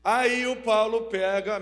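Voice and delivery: ordinary delivery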